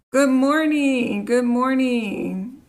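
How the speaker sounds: slow, high, drawn-out good morning; sing-song greeting. Real, not acted. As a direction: greet the chat brightly.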